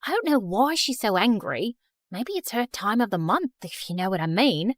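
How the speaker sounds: put-on offhand British accent. Student explaining herself to the principal, British accent. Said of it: "stop with that British accent".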